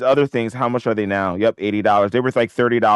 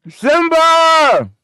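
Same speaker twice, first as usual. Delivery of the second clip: high, loud cheer; excited shout; 6 dB louder than usual. Real, not acted. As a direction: call the name out in delight. Cheering a stock spike.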